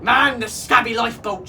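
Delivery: urgent captain's command; captain's shout. A narrator's reading. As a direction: shout it to the whole crew. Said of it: "shouted Captain Snatchit".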